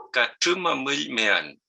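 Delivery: slowly sounding out "Kachuma mean" syllable by syllable; slow Mapuzungún word. Shown as a demonstration. Teacher models a Mapuzungún word, slow and careful. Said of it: "Por ejemplo".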